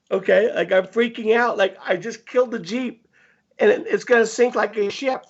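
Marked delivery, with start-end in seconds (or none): none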